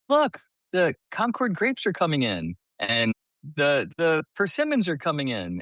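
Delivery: high, eager quoted call; imitating excited wife. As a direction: pitch it up like an excited wife pointing out news. Wife's excited quote, acted.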